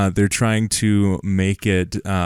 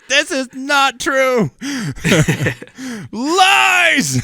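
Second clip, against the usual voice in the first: very high squeaky mock outrage; hammed-up falsetto protest; mock outraged rant; 4 dB louder, much slower than usual. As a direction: shriek it like a ranting fanboy. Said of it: "after that little side rant of like".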